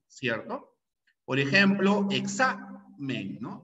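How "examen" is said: examen drawn out slowly, stressed on XA; stress on penultimate syllable. Shown as a demonstration. Teacher showing a palabra grave, examen slowly with the stress on XA. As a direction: break examen up slowly, weight on the middle syllable. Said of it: "Acá, fuerza de voz".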